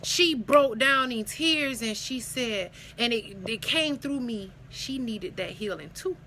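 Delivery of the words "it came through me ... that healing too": ordinary talk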